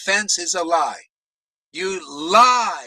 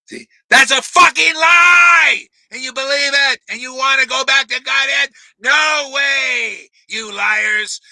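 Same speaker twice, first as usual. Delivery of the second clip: furious shout; 7 semitones higher, 10 dB louder than usual. Real, not acted. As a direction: blast it at the followers. Preacher erupts at the liars.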